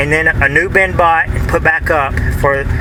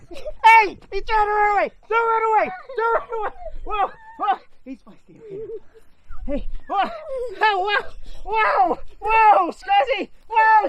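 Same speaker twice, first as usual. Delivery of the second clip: much higher, excited calls after a fleeing cat; calling after cat; 7 dB quieter than usual. Real, not acted. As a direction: chase the cat with high, eager shouts. Chasing the cat Scuzzy.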